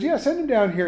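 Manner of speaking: high, loose and offhand; casual paraphrase. Retold or imitated. Pastor paraphrasing Elisha to the congregation, breezy and offhand. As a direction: toss it off like it's no big deal. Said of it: "He goes".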